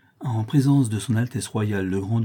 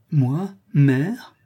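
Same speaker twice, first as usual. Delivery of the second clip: high, incredulous and bitter; despairing bitterness; 4 semitones higher, 4 dB louder than usual. A narrator's reading. Daughter who feels unworthy of motherhood, bitter despair. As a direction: ask it in wounded disbelief. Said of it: "avec une amertume désespérée".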